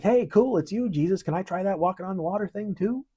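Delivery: put-on like a kid spotting a cool trick and asking to try it; hammed-up eager "Hey, cool" as Peter. Peter voiced eagerly asking Jesus.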